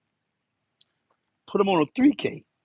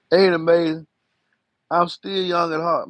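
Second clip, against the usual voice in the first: ordinary delivery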